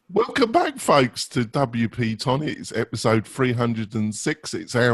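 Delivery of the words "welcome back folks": bright, high host welcome; show host intro. Acted. Show opening host announcement.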